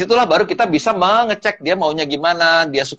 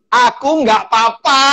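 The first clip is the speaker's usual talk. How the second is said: slow, raised, drawn-out "I'm fine"; acted angry denial; 5 semitones higher, 3 dB louder than usual. Wife showing husband how her text should be read, mock angry. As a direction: stretch it out so it clearly means the opposite. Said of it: "Bacanya yang bener".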